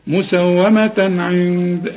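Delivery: مسومة عند with the N of عند hummed, slowly; ghunna. Shown as a demonstration. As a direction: hide the N in عند. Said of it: "هتغنها".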